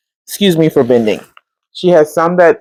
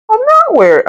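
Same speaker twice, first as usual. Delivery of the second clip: high emphatic refusal. Real, not acted. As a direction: refuse it outright. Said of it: "emphatic refusal".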